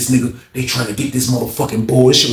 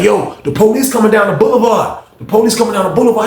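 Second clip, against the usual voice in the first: high, urgent retold alert, quicker on the repeat; called-out warning; 8 semitones higher than usual. Inmates in cell two warning everyone, urgent call. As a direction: sound the alarm, pitched up.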